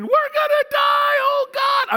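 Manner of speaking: high, loud terrified scream; panicked screaming. Retold or imitated. Plane passengers screaming in sudden turbulence, terrified shout. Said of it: "people are screaming".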